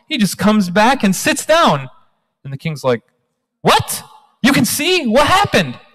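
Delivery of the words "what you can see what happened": high, startled king demanding answers; the king can't believe the blind man sees, gasping high; shocked king. Acted.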